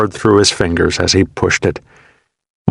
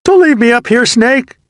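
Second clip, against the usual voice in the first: much higher, louder pleading cry; desperate call; 5 dB louder than usual. A narrator's reading. Wounded soldier calling after his buddy, desperate pleading shout. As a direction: beg him loudly not to go. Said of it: "Marston called to him".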